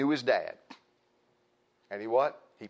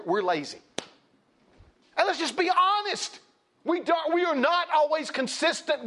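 high-pitched, fervent exhortation; impassioned preaching; 12 semitones higher, 3 dB louder than usual. Real, not acted. Preacher admonishing parents about consistency, impassioned and high-pitched. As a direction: drive it home to the congregation, voice raised high.

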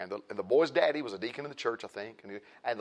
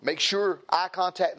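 ordinary talk, nothing marked

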